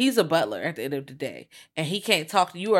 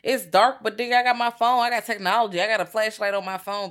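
higher-pitched, insistent objection; acted protest; 5 semitones higher than usual. Host imagining her reply to a warning, acted protest. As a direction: argue back, piling up reasons.

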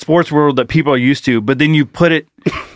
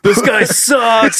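heckling fan; 6 semitones higher, much slower than usual. Pretend. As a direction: jeer from the bleachers.

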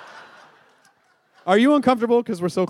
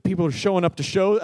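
normal talking voice

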